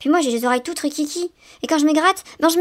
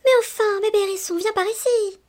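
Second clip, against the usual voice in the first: put-on higher voice calling the baby back; a mother chasing her child, calling; calling after him; 6 semitones higher than usual. A narrator's reading. Mum calls after the panicking baby, higher call. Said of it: "en essayant de le calmer".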